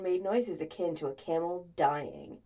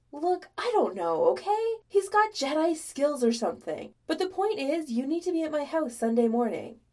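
higher, louder exasperated explanation; flustered insisting; 6 semitones higher, 4 dB louder than usual. A narrator's reading. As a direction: brush off the question and insist. Flustered arguing on the phone.

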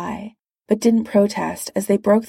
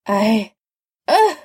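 groggy; 3 semitones higher, 4 dB louder than usual. A narrator's reading. Spider-Man under Doom's foot, groggy and choked off. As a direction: begin woozily, then yelp it. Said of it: "in a groggy voice".

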